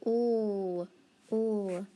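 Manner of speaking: L as a held ull sound, repeated; L, L as two isolated lll sounds; letter sound. Shown as a demonstration. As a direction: hold the L sound, not its name. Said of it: "how about".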